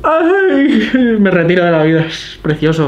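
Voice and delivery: high, loud gushing cry of delight; swooning, almost-crying delight; overwhelmed squeal. Real, not acted. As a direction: squeal like it's too beautiful to bear. Overwhelmed by beautiful illustrations.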